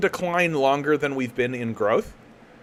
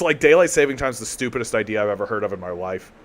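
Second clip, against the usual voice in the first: ordinary talk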